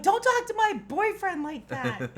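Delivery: high, slow, loud mock-jealous yell. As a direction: holler it in playful outrage. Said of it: "I just yell out".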